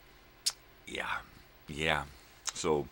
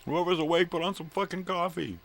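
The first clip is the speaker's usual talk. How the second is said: high, loud wake-up holler; yelling out; 8 dB louder than usual. Acted. Streamer calling sleepers to make coffee, loud high-pitched yell. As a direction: holler it out to whoever can hear. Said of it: "just yell out".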